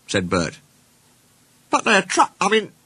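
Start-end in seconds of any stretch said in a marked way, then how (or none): 1.7-2.7 high, awkward self-correction; finishing lamely. Acted. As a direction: break off, then recover feebly in a higher voice. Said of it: "he finished lamely".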